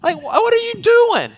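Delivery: exasperated yell at another car, rising high; mock shout through the windshield; shouted at a driver. Pastor yelling at a driver at a stop. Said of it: "I simply shouted".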